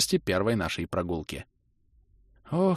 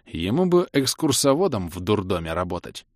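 usual speaking voice

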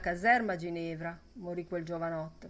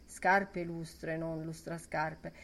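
ordinary delivery

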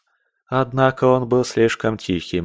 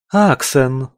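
female voice; 3 semitones higher, 4 dB louder than usual. Acted. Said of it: "Самый первый голос, женский".

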